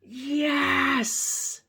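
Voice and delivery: high, loud, long "yes"; excited exclamation. Acted. Piggy agreeing to share, loud high excited cry.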